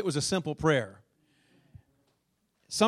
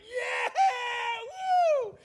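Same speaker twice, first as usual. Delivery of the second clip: high joyful whoop at full speed; excited yell. Retold or imitated. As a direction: let out a thrilled high woo. Joyful yell on four-wheeler.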